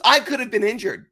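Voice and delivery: mock indignant complaint. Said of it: "She comes up angry".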